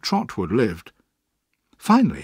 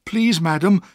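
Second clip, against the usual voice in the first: brave plea; 6 semitones higher, 4 dB louder than usual. A narrator's reading. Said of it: "I said bravely".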